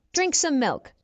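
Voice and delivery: normal talking voice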